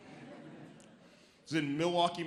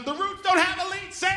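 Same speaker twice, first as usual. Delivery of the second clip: much higher, loud outburst; exasperated rant; 8 dB louder than usual. Real, not acted. Comic ranting about being mistaken for a Roots member. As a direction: blow up at the absurdity. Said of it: "comic's outrage peak".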